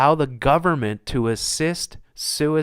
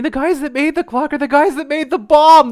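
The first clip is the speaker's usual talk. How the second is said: worked-up outrage; 12 semitones higher, 9 dB louder than usual. Real, not acted. Outraged reveal of clock makers.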